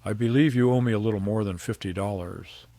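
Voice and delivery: father confronting the man who broke his work, calm and cutting; calm and precise. A narrator's reading. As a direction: deliver the bill coolly. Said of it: "my voice calm, precise, cutting through".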